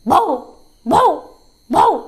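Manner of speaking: short, gruff dog barks on "ബൗ"; abrupt woofs, one at a time; dog barking. Shown as a demonstration. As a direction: bark "ബൗ" like a real dog. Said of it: "എങ്ങനെയാ?".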